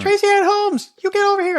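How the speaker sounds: high, held calling voice; imitated mom's yell. Mom stuck in manure summons child, imitated high yell. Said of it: "she's still yelling at me".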